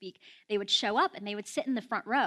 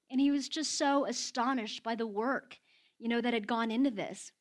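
ordinary delivery

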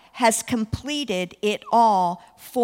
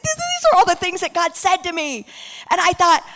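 louder, higher, eager rush; excited; 6 semitones higher, 7 dB louder than usual. Retold or imitated. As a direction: gush it out like a thrilled new believer. Excited young believer telling Mary.

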